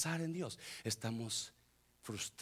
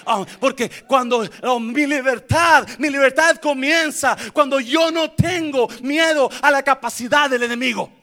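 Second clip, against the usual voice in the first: shouted, high, relentless sermon; preacher at full shout; full-force preaching; 11 semitones higher, 20 dB louder than usual. Real, not acted. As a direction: build to a roaring climax and hold it there.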